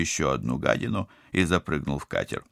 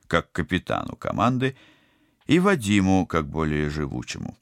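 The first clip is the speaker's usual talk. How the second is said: plain reading voice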